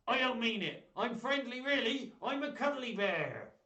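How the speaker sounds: high, loud put-on puppet voice, slowing at the end; puppet pirate. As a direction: insist you're friendly as the puppet. Pirate puppet reassures host.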